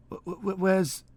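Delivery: high stammering, stuck on wh-. Acted. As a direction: stutter it out, dazed and high. Wounded man stammering.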